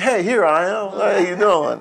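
casual God. Retold or imitated. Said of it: "and God said".

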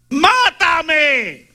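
pleading "Mátame" as Elijah; acted plea. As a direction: wail "Mátame" like a desperate prophet. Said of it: "y le dice a Dios".

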